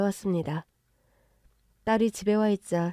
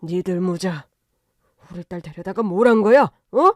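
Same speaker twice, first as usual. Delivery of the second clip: angry demand rising to a shout; enraged father; 7 dB louder than usual. Acted. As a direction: confront the son-in-law, building in volume. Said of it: "남편이 눈이 뒤집힐 기세로".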